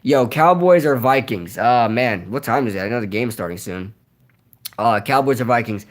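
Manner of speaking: normal talking voice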